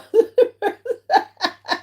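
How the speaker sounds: long high giggle; real amused giggle; high laugh. Real, not acted. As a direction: let a high giggle run out. Laughing at a chat comment.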